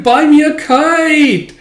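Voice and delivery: very high, loud, drawn-out demand; slow, insistent child's wail; whining child. Acted. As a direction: stretch it out like a nagging kid.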